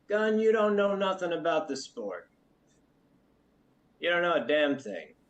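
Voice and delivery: high, loud brash cook; gruff cook voice. Acted. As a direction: bark like a rough camp cook. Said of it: "Cook laughs from the other room".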